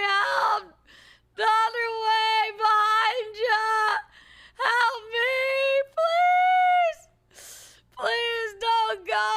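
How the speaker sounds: shouting for help, voice cracking with desperation; calling for help. Acted. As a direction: yell to the passerby as if stranded. Calling out to distant rescuer.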